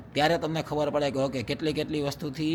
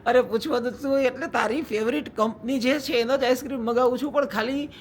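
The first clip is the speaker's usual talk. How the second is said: raised-pitch retort repeating her; mock indignant; 8 semitones higher than usual. Husband echoing his wife's complaint, mock indignant. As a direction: bounce her question back, higher.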